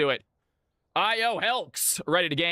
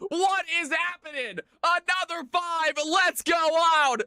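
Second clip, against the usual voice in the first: excited hype; 8 semitones higher than usual. Real, not acted. Hyped stream opening, laughing.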